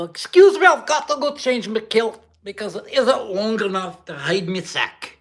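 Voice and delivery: cartoon ogre welcoming the stream, hammed-up; Shrek. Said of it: "That was Shrek".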